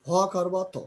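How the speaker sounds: usual speaking voice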